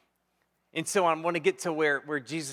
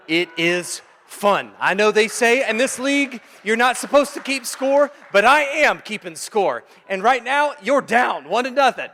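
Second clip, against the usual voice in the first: higher, louder preaching build; fervent preaching; 7 semitones higher, 10 dB louder than usual. Acted. Preacher telling the congregation winning matters, full force. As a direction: drive the point home from the pulpit.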